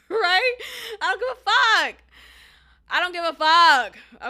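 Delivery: loud, emphatic dismissal; exasperated outburst. Real, not acted. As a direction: blurt it out loud, then hammer it. Venting after mocking his Miami excuse.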